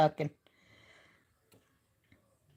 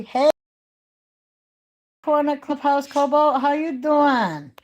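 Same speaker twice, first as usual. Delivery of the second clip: cheery greeting; 7 semitones higher, 4 dB louder than usual. Acted. Greets a viewer.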